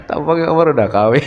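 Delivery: quick tease breaking into laughter; amused teasing. Real, not acted. Teacher teasing Amar about looking married, laughing. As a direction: say it fast on the edge of laughing.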